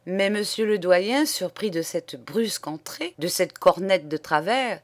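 plain reading voice

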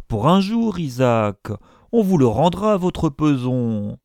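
insist, slowing on the last words; impatient insistence. A narrator's reading. Impatient professor presses the reluctant usurer. (insistance impatiente)

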